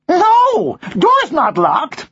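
high, slow American parrot; American accent. Acted. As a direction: drawl it as a high-voiced American bird. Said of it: "you have an American accent".

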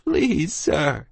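put-on high pleading child. A narrator's reading. Tearful child begging the doctor for help, pleading. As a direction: beg in a high, small cry.

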